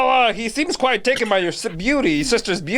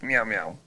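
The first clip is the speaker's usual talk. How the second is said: soft low meow; 12 semitones lower, 7 dB quieter than usual. Pretend. Laugh then cat noise.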